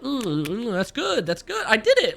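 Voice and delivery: high, pleased mm-mm; mock satisfied taste. Tasting the vanilla pudding, high pleased mock voice. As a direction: savour it in a squeaky voice.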